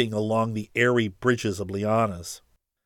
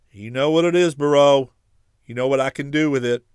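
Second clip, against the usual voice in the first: threatening; 4 semitones higher, 8 dB louder, faster than usual. Acted. Ferris threatens Barreau with vial.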